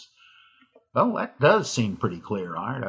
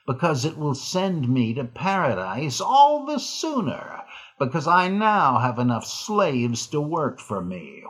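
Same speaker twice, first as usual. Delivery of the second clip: villain voice; 3 semitones higher than usual. Acted. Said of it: "read the solution in your best villain voice".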